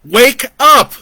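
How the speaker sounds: very loud, high yell; hollering. A narrator's reading. Joe hollers at Brandon to wake, very loud. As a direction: scream at him to wake. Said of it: "he hollered".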